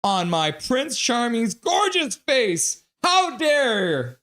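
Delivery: high, slow, loud indignant squeal; mock squeal. Voicing Greta Thunberg's line from the chat as a slow, high squeal. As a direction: shriek it out in mock outrage. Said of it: "Greta Thunberg squeals".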